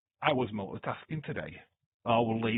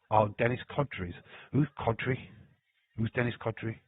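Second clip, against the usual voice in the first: ordinary talk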